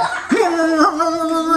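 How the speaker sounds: high, loud wobbling mock-fit cry; imitated fit noise. Storyteller shows children the man's fit, high wavering noise. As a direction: imitate someone thrashing in a fit. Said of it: "somebody doing like that".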